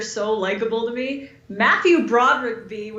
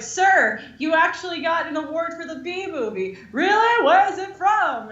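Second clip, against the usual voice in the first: higher, bright announcing; mock announcer voice; 7 semitones higher than usual. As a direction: announce it like a herald. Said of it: "it's like".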